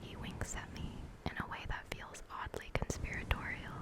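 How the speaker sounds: ASMR story whisper; whispered narration. A narrator's reading. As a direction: read the novel in a whisper.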